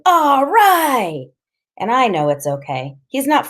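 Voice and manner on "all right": high, loud whoop; exhilarated kid's shout; excited shout. A narrator's reading. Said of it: "Freak is shouting".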